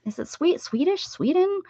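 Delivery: rising, high, unsure tries; hesitant high questioning; uncertain questioning. Real, not acted. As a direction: test the word out doubtfully. Unsure which word is right.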